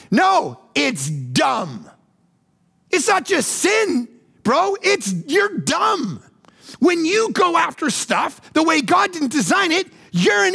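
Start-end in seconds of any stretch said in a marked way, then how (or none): 2.9-6.2 slow, high, punching each word; blunt emphasis. Real, not acted. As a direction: drive home that it is dumb. Preacher scolding congregation.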